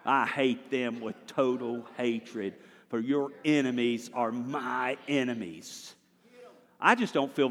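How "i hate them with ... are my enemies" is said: slow reading. Shown as a demonstration. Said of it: "Let's say it right".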